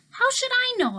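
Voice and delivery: snapped, high and loud. A narrator's reading. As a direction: bite his head off. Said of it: "I snapped".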